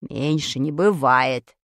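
put-on low, slow, firm boy's voice. A narrator's reading. Vadik objecting to the tiny apple, firm. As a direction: state it firmly, low and unhurried. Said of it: "твёрдо заявил Вадик".

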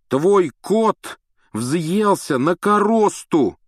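high, loud, slow accusing; angry accusation. A narrator's reading. Рон обвиняет кота Гермионы, гневно. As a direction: hammer the blame home. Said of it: "не отступал Рон".

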